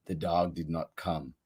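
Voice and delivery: ordinary delivery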